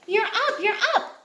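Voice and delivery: high, eager cheer; bright excited child call. Acted. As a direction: call out gleefully to a sleepy sister. Excited child wakes sister.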